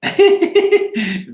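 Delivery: high, giggly burst of laughter; real high-pitched laugh. Real, not acted. Laughs at live chat.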